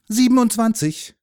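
high, loud statement with falling end; boyish, sure of himself; confident answer. A narrator's reading. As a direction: announce the answer proudly. Boy proudly gives the answer.

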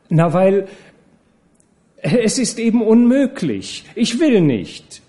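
high, emphatic no; firm refusal. A narrator's reading. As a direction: shut it down with emphasis. Nana firmly refuses.

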